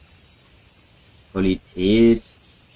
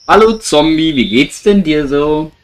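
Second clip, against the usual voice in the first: taunting; 6 semitones higher, 5 dB louder than usual. Real, not acted. Taunting the zombie in game.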